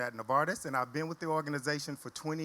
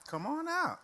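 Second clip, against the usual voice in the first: calling out; 13 semitones higher than usual. Real, not acted. Host coaxing shy panelists out.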